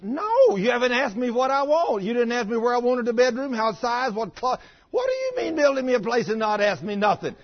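loud, high-pitched indignant scolding; imitated wife hollering. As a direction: mimic an upset wife screaming at her husband. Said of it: "she hollered at me".

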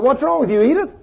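high concerned call across the room. Retold or imitated. Preacher calling to Edith across the cafeteria, high and concerned. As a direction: call her name up high, worried.

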